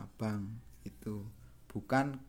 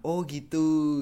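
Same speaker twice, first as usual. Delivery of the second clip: "oh gitu" louder and higher, as a realization; follow-along "oh gitu"; 4 semitones higher, 6 dB louder, slower than usual. Shown as a demonstration. Teacher shows students the Indonesian acknowledgement, raised, emphatic "oh gitu". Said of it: "kalau bahasa Indonesia kita punya".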